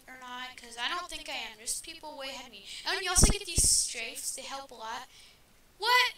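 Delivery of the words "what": high, loud sudden what; surprised yelp. Real, not acted. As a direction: blurt it out startled.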